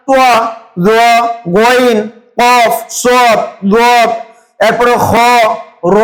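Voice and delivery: Arabic letters spaced out, "তোয়া, জোয়া, গইন" with rounded heavy vowel, not aa; no alif vowel. Shown as a demonstration. Presenter lists eight Quran letters for readers, rounded heavy pronunciation. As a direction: recite "কফ, সদ, দদ, খ, র" one by one, vowels rounded. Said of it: "জবর থাকলেও আকার উচ্চারণ হবে না".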